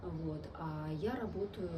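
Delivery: ordinary talk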